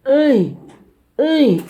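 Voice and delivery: high, very loud repeated call; mimicked shout. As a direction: yell for attention in short bursts. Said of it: "That's when he shouted at you".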